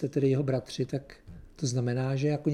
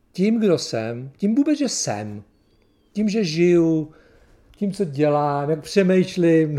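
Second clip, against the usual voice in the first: loud, drawn-out list, phrase by phrase; emphatic realisation; 2 semitones higher, 7 dB louder, slower than usual. Retold or imitated. Preacher retelling how his guilt dawned, loud and emphatic. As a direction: hammer each item out slowly.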